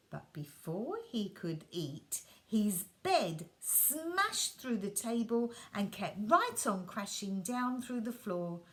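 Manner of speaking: ordinary delivery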